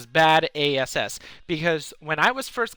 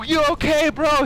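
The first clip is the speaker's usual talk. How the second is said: urgent check; 11 semitones higher, 4 dB louder than usual. Retold or imitated. Acted urgent exchange during crash.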